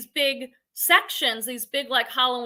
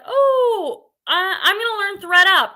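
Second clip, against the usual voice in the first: higher and louder, overeager; imitating her own eagerness to try a new platform, higher and louder; mock eager self; 7 semitones higher, 7 dB louder, slower than usual. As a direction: play your own overexcited resolve. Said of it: "I'll be like".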